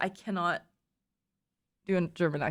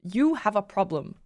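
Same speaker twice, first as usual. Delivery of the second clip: ordinary delivery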